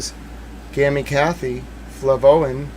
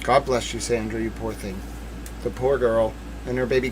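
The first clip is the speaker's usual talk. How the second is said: softer, lower, sorrowful; tender pity; 5 semitones lower, 7 dB quieter than usual. Real, not acted. Streamer speaking of the victim, soft and sorrowful. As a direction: mourn her gently.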